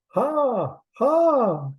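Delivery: high squawking bird laugh. A narrator's reading. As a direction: cackle it like a bird. Changed into a bird.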